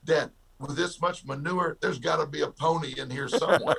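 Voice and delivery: put-on dad asks why his son is so excited, delighted kid voice. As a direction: gush like a kid who just found a surprise. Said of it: "what in the world are you so excited about".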